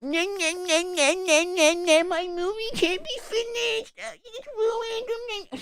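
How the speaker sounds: high, drawn-out mocking whine; whiny baby. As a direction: imitate a bawling little crybaby. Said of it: "A whiny little baby".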